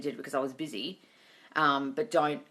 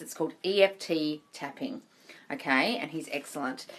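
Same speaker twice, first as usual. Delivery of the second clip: usual speaking voice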